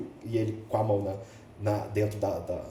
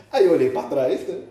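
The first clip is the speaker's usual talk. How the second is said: ordinary delivery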